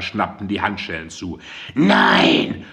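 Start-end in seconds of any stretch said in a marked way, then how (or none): 1.7-2.6 much higher and louder shriek; piercing scream. A narrator's reading. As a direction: scream it so the whole block hears. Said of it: "schrie Mandy mit gellender Stimme".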